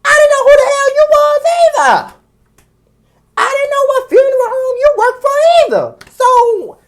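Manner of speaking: very high, loud outburst; indignant yelling. Real, not acted. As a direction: shriek it at them in indignation. Rant peaks at the person criticized.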